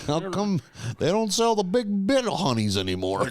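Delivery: high, creaky codger complaining; old man voice. Pretend. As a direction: grumble like a cranky grandpa.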